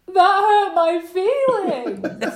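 put-on high, slow, whiny child sobbing it out; mock crying child. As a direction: whine it like a crying little girl. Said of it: "she cries and says".

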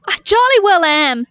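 high, loud and triumphant. Real, not acted. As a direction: crow it proudly. Triumphant claim.

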